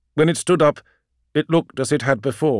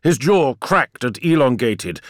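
ordinary delivery, nothing marked

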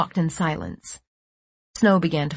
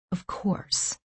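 breathy stunned whisper; 8 dB quieter than usual. A narrator's reading. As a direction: whisper it to yourself. Repeated inner realization, whispered.

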